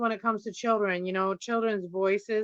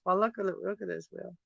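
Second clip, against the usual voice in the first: ordinary talk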